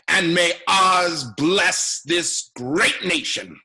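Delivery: building from firm to loud, slow oratory; slow slogans swelling into a loud patriotic finish; rally slogans. Acted. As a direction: crescendo like closing a campaign rally. Candidate ends with rally slogans.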